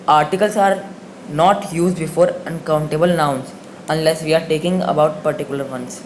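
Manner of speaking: slow, loud dictation of the rule; slow rule reading. Shown as a demonstration. Teacher reading a grammar rule aloud to students, slow and deliberate. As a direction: read the grammar rule slowly, word by word. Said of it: "reads the rule from book".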